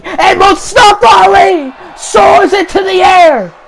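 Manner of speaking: roaring play-by-play call; excited shout. Real, not acted. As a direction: sell the power bomb to the arena. Big power bomb, crowd moment.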